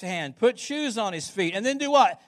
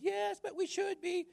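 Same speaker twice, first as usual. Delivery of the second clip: high prissy sing-song, softer; mocking churchy commenter; 11 semitones higher, 8 dB quieter than usual. Pastor mocking a Facebook commenter, high prissy voice. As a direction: poke fun at the pious online commenter. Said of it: "they don't talk that way. That's the way I talk".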